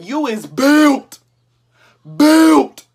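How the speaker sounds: slow, then "Built" punched loud; slow drawn-out roast. Pretend. Roasting a friend, slow mock drawl into a loud punch. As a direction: drag it out then hit the last word.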